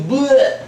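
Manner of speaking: loud high mock retch; exaggerated throwing-up noise; imitated puking. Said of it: "like, puking their guts out".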